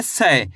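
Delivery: 'से' को 'ऐ' की मात्रा से, 'सै' जैसा बोलें; 'से' में खुला 'ऐ' स्वर, गिरते सुर में; सही उच्चारण. Shown as a demonstration. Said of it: "तो सा का उच्चारण कैसे करेंगे?". ('से' का सही 'सै' वाला उच्चारण)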